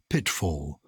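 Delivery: "pitfall" with a clearly sounded T; careful diction. Shown as a demonstration. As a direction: articulate the consonants in "pitfall". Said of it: "consonants for clarity".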